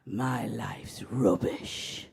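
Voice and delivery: mock-doleful old lady; gloomy old nan. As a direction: sigh it out like a grumpy grandmother. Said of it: "And she'd go".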